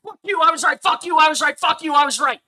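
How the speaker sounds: repeated high, loud gloating yell; Razor Fist rant. Retold or imitated. Streamer borrows a fellow critic's catchphrase to gloat, yelling loud and high. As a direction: bellow it like a victory chant. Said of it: "let me steal a little bit from Razor Fist".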